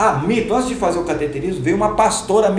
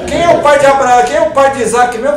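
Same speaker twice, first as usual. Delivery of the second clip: loud, high quiz question; doctor quizzing loudly; 10 semitones higher, 13 dB louder than usual. Retold or imitated. Doctor quizzing the pastor on the table, loud and high. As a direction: fire the Bible question at him.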